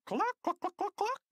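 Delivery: very high clucking like a hen; hypnotized principal clucking as a chicken. A narrator's reading. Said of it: "he cried".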